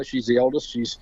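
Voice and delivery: normal talking voice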